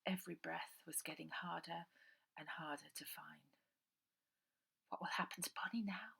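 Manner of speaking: ordinary delivery, nothing marked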